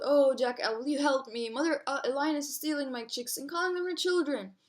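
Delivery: plain reading voice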